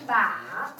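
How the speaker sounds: "ba" dipping low, then turning up; dipping third tone. Shown as a demonstration. Teacher models tricky third tone, falling then rising. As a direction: drop "ba" down and bend it back up. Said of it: "in the middle and then go down".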